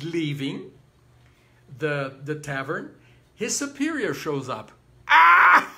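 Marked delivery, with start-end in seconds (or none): 5.1-5.7 sudden loud high operatic "Ah!"; mock scream. The superior shows up at the tavern, mock shriek. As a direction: burst out with a theatrical cry, far above speaking pitch.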